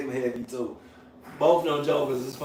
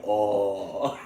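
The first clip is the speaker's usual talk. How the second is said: normal talking voice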